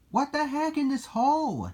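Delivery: high-pitched, startled puzzlement; baffled question. Acted. Gilbert Junior finds a strange hole, high baffled voice. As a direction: wonder aloud, high and baffled.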